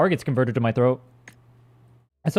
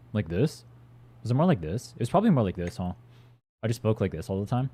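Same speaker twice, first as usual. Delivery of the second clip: put-on old monotone voice; 5 semitones lower, 5 dB quieter than usual. Shown as a demonstration. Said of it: "It was a lot more monotone".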